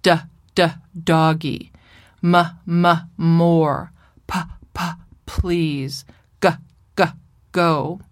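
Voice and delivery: repeated d, m, p, g sounds stressed before each word; consonant drill. Shown as a demonstration. A therapist demonstrating typical consonant modelling. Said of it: "In modeling doggy, we typically say".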